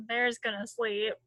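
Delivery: plain reading voice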